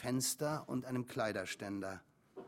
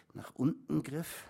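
usual speaking voice

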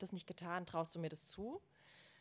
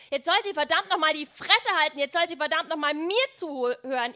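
full-force high yell; explosive, very loud rage; furious shouting; 7 semitones higher, 14 dB louder than usual. A narrator's reading. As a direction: scream it at Mother down the phone. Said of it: "schrie ich".

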